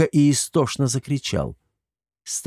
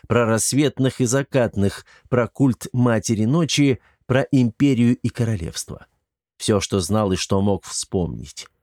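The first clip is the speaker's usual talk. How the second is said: ordinary delivery, nothing marked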